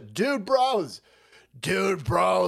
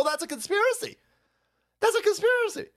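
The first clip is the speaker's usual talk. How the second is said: mocking voice; 13 semitones higher than usual. High mocking voice.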